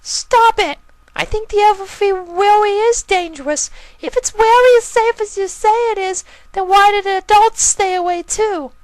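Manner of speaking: a small filly protesting hard; squeaky filly. Acted. Filly arguing with her brother about the forest, very high and indignant.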